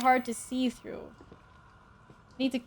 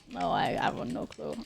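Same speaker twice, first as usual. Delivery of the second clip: whispering; 7 semitones lower, 6 dB quieter than usual. Real, not acted. Whispered aside.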